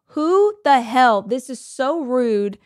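loud, slow, indignant outburst; outraged. Retold or imitated. She recalls a stranger interrupting her lunch, outraged and loud. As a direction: blurt it out at a rude stranger. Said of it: "I was like".

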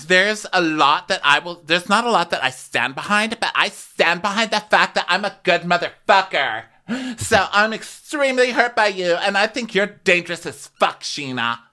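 wounded but defiant, overplayed; mock indignant Lala. Voicing Lala's indignant speech.

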